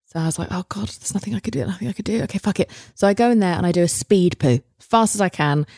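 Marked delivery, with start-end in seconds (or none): 0.5-2.7 hushed, panicky self-talk; panicked murmur. Retold or imitated. Alone in the bathroom, panicking, in a hushed voice. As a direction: mutter it fast to yourself in panic.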